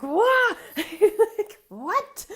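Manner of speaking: high, loud laughing disbelief; shocked what. Retold or imitated. Client hearing she needs three months off, high loud shocked laugh. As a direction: shriek it with a laugh, stunned.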